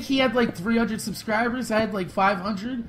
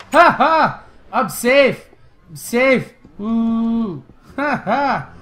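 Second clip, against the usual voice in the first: relieved cheering; 6 dB louder, much slower than usual. Real, not acted. Gamer reaching the shelter after the chase, relieved cheering. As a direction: celebrate that you made it out alive.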